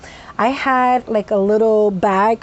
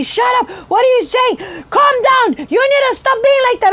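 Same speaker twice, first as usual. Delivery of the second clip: imitating a high, loud telling-off; yelling; 14 semitones higher, 5 dB louder than usual. Psychiatrist ordering patient to calm down, yelling. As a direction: shout them down, pitched high. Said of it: "she was yelling at me and shouting".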